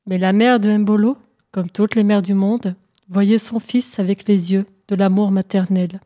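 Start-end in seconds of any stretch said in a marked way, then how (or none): none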